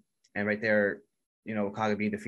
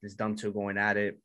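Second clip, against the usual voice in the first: ordinary delivery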